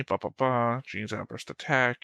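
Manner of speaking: ordinary talk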